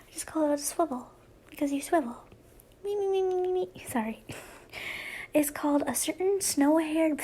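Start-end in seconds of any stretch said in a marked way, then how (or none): 2.8-3.7 high, rapid little squeaky chant; silly "me-me-me" noise. Pretend. Goofing about the swivel sign, silly high noise. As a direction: rattle off a playful high run of me's.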